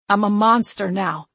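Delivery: forceful, embittered accusation; spat. A narrator's reading. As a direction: spit the words out angrily. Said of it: "he spat".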